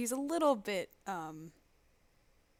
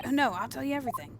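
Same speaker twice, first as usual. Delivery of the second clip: loud, high, rushed surrender; panicked; 6 semitones higher, 8 dB louder, faster than usual. Acted. Threatened prisoner giving in, acted panic. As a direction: blurt it out in mock panic.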